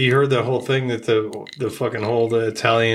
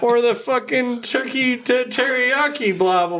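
higher, laughing pitchman voice; mock ad voice; 10 semitones higher than usual. Friend mimicking the fat brother's sandwich ad, higher and laughing. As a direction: pitch the meat combo like a hammed-up ad.

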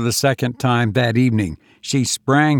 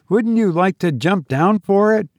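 put-on high, girlish teasing; mischievous; 9 semitones higher than usual. A narrator's reading. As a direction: tease him playfully, pitched high. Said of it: "she asked mischievously".

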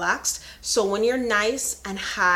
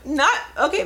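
laughing; 13 semitones higher than usual. Real, not acted. Laughs at her own overclaim.

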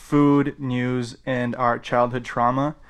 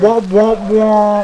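muffled wah wah talk; 9 semitones higher, 11 dB louder, much slower than usual. Pretend. Host echoes the muffled sound.

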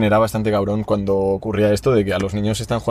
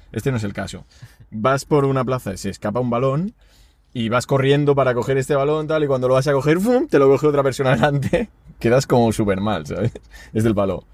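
ordinary delivery

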